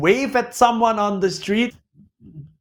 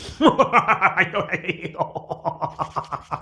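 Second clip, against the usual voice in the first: giggle. Real, not acted. Laughs at own joke.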